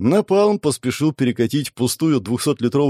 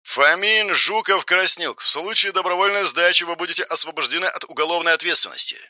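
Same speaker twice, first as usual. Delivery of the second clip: higher-pitched tinny loudhailer voice; halting over the surnames, then read it out officially; megaphone announcement read from paper; 6 semitones higher than usual. A narrator's reading. Policeman reads surrender terms to the besieged, megaphone announcement. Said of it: "дальше текст стали уже читать по бумажке".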